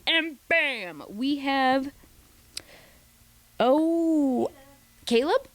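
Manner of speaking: normal talking voice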